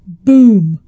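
a sudden loud low boom. Acted. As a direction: drop it like a thunderclap. Punchline after Genesis quote.

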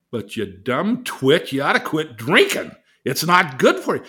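loud, stern scolding. Retold or imitated. God scolding Craig for drinking, loud and stern. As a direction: scold him like a fed-up father. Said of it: "Or did he say".

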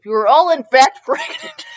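laughing. Real, not acted. Reader laughs at own line.

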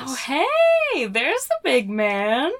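high, bright fake delight; feigned excited surprise. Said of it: "She feigns excited surprise".

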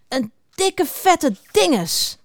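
high, drawn-out grumbled insult; mopperend. A narrator's reading. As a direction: stretch out the grumpy name-calling. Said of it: "Zo mopperden ze nog een tijdje door". (langgerekt, hoog gemopper)

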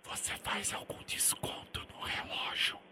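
barely audible prank whisper. Pretend. Prank caller to the seller, a near-silent whisper. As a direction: whisper it so he can't hear. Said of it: "prank whisper".

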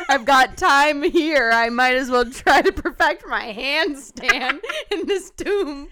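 playful mock character. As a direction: act out the bored girl, amused. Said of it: "She's like".

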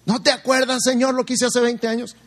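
voz alta y aguda, insistiendo; súplica aguda. Pretend. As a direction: insiste a Dios, más agudo y fuerte. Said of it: "creyente insiste alto". (insistiendo angustiado, agudo y fuerte)

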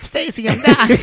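high, loud hammed-up wail; self-mocking high-pitched panic; mock panicked cry. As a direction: cry out melodramatically as if on your deathbed. Replays his panicked cry to Stacy.

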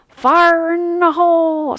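high, loud, slow booming call; ride announcer voice. Retold or imitated. Ride's voice calling out the drop, loud and slow. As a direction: boom it like a ride's recorded voice. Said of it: "this loud voice".

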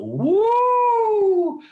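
high, loud Ric Flair woo. Retold or imitated. Imitating Ric Flair for Piper, loud woo. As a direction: let out a screaming Flair woo. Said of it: "started cutting a couple Ric Flair promos".

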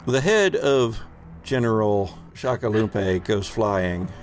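ordinary talk

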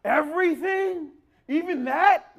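put-on loud, high-pitched disbelief of a balking child; sarcastic kid. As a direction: drag the words out, incredulous, way up high. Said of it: "they begin to speak in sarcastic language".

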